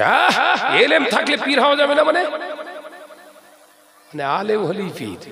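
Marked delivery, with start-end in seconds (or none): 0.0-2.2 high, fast, sneering mimicry of a cleric with a laugh; mock the preacher's scoffing reply, pitched up and hurried; parodying a rival maulvi's indignant question. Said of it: "বলছে".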